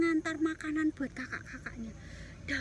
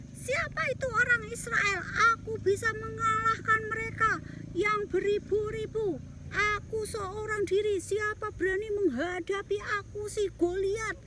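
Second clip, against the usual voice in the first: boastful giant; 5 semitones higher than usual. Acted. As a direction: crow over the frightened army. Goliath boasting.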